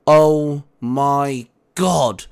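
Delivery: loud, drawn-out exclamation; blurted. A narrator's reading. Dante reacting to William's news, loud stretched blurt. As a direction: blurt it out in shock. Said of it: "Dante blurted".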